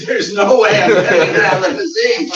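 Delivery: loud, laughing no-way refusal; half-laughing loud refusal. Retold or imitated. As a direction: turn it down with a big laugh. Refusing to get in limo.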